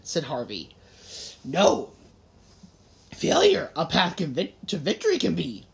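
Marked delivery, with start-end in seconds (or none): 1.4-5.7 put-on strained old Yoda voice. A narrator's reading. Yoda figure voice.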